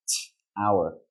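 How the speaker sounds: T- released with a long burst of air, then HOUR; forceful aspirated T. Shown as a demonstration. Teacher shows learners the air in tower, forceful aspirated T. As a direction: split off a strong airy T- before HOUR. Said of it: "give it more force and more".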